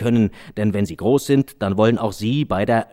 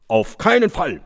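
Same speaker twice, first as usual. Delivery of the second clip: police calling out; 7 semitones higher, 3 dB louder than usual. Acted. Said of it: "riefen die Polizisten".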